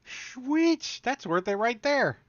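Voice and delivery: high excited cheer. Real, not acted. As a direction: cheer it, delighted. Cheering a good result.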